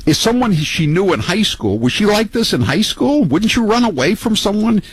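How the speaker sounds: high, incredulous mockery; mocking disbelief. Real, not acted. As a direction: pile on the ridicule, way up high. Host mocking her marriage.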